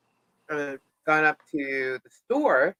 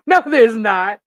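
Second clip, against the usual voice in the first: disbelieving; 4 semitones higher, 10 dB louder, slower than usual. Retold or imitated. Arguing with Robbie in disbelief.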